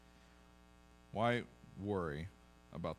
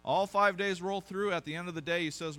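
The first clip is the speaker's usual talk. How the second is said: rising, louder preacher build on each "it's good"; full-force preaching; 4 semitones higher, 4 dB louder than usual. Acted. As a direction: preach it up, day by day, getting louder. Preaching the Genesis refrain.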